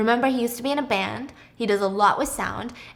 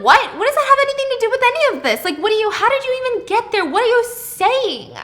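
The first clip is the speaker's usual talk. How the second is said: high, indignant disbelief; shocked; 13 semitones higher, 7 dB louder than usual. Retold or imitated. Margot answering her husband's love question in high indignant disbelief. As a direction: fire back in outraged bewilderment, much higher.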